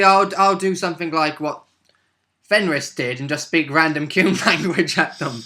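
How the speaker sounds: ordinary talk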